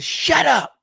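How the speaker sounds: high, loud bratty snap; kid yelling. Retold or imitated. Rude kid yelling at the adult, high and loud. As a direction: yell it like an angry kid.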